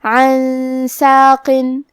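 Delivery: عن ساقن, noon hidden in a slow nasal hum; عن ساقن with ikhfa, drawn out; hidden noon with ghunna. Shown as a demonstration. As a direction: recite عن ساقن slowly with ghunna. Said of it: "غنہ کے ساتھ ادا کریں گے".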